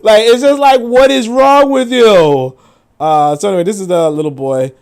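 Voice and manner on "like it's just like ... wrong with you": loud, slow, exasperated disbelief; really fed up. Real, not acted. Reacting to a joke, loud and slow in disbelief.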